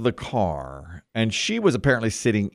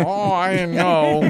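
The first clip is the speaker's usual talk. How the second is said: slow, nasal honky whine; 5 semitones higher, slower than usual. Shown as a demonstration. Showing how he talks to the woman, slow and nasal. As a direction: drag it slowly through the nose. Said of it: "a guy who talks like this".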